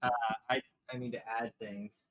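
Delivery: ordinary talk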